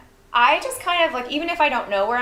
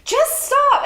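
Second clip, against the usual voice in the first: high, louder cry of stop; mom yelling; 6 dB louder than usual. Retold or imitated. Mom in the cold yelling at her daughter to stop. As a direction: imitate a fed-up mother's yell. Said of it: "she just goes".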